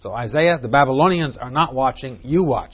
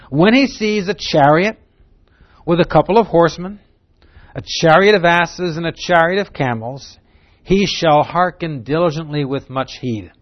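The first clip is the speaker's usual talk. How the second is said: raised, louder reading voice; proclaiming the scripture; 5 semitones higher, 6 dB louder than usual. Acted. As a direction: read the verse out with weight.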